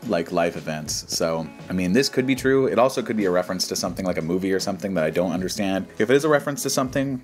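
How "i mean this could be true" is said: normal talking voice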